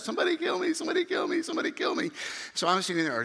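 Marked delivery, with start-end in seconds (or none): 0.0-2.1 mock screaming. Bleeding man on the truck begs bystanders, mock high screaming. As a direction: imitate a desperate man in a high wail.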